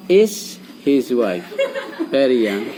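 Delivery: put-on "Is his wife very young?" spelled out very slowly, word by word; teacher slowly models the inverted question for pupils, slow and clear. Shown as a demonstration. Slow and clear. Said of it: "kalau ingin bertanya dengan tata bahasa, dibalik aja".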